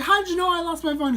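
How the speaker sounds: loud, high, fast retold challenge; defensive. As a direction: fire the question out accusingly. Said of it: "I was all defensive".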